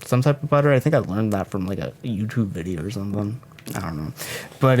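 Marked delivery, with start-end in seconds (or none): none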